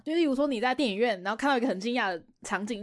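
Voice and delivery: normal talking voice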